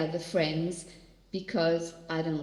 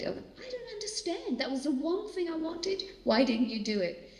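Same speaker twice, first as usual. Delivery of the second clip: higher, softer, pleading question; retold heartbreak; 10 semitones higher, 4 dB quieter than usual. Mother asks God why, soft pleading heartbreak. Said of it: "But I had to work through it".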